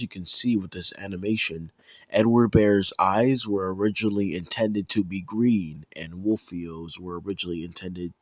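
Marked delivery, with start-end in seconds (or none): none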